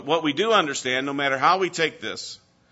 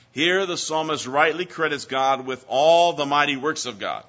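usual speaking voice

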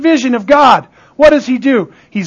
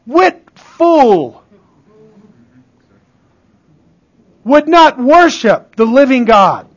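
drawn-out, punched FOOL with long pauses; added emphasis; slower than usual. Shown as a demonstration. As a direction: preach it with heavy emphasis, pausing after "What fool". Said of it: "Or shall I add a little emphasis?".

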